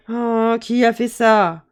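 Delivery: slow, loud fairy mother voice; a stern mother calling down the chimney; big voice. Acted. Fairy mother demanding who complains, slow and loud.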